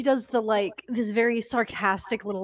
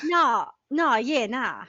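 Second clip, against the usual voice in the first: exaggerated Australian accent with long vowels; Australian warm-up; slower than usual. Shown as a demonstration. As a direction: warm up into a broad Aussie accent. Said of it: "to get into your Australian".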